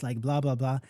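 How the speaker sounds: ordinary talk, nothing marked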